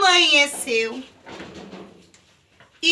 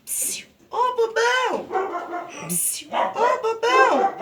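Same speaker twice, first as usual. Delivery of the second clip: taunting call; 5 semitones higher, slower than usual. Acted. Léo taunting Pedro from hiding.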